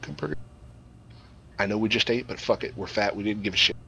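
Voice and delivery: ordinary delivery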